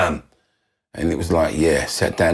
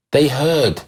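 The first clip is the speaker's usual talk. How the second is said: ordinary talk, nothing marked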